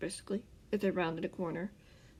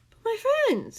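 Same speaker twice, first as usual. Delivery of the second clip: high pleading Scar voice; insisting; 6 dB louder than usual. A narrator's reading. Scar insisting to the man, higher and pleading. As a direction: press the point in a higher voice. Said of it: "Scar insisted".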